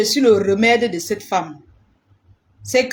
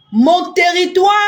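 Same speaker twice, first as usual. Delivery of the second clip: emphatic warning; 6 semitones higher than usual. Real, not acted. Woman warning rivals off her territory, raised emphatic call.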